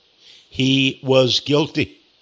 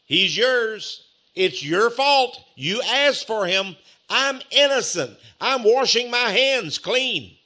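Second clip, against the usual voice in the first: slow, higher dismissive Pilate; Pilate washing his hands; 6 semitones higher, slower than usual. Acted. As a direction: hand the blame back, slowly. Preacher voicing Pilate, slow high.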